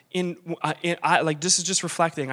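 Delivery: usual speaking voice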